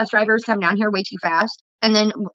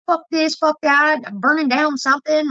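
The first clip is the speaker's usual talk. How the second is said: higher-pitched mock tirade; imitated neighbor ranting; 6 semitones higher, slower than usual. Neighbor cussing within earshot, imitated ranting. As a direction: mimic an overheard rant.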